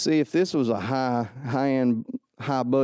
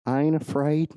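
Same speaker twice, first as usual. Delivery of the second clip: ordinary talk, nothing marked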